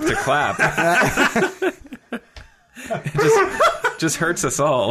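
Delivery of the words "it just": laughing hard. Real, not acted. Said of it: "laughing hard".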